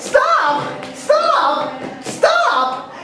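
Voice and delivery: high panicked cries dropping each time; falling panicked yells; screaming stop. Acted. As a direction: beg him to stop, each fainter. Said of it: "screaming at the top of his lungs".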